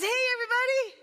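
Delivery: high-pitched, triumphant greeting; cheery calling out. Acted. Rabbit arriving home to the animals, calling out brightly. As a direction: call out high to the whole crowd.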